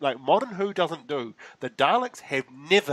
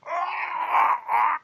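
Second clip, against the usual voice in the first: very high screaming cry of pain; agonized scream. Retold or imitated. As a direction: scream as if being murdered. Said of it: "someone is actually properly being murdered".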